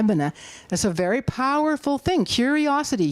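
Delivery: ordinary delivery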